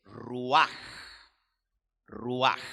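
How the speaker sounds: "ruaj" slow and loud, stress on the end; Hebrew pronunciation. Shown as a demonstration. Teacher giving listeners the Hebrew word for breath, emphatic Hebrew "ruaj". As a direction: say "ruaj" with a long, rasped final j. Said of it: "se dice".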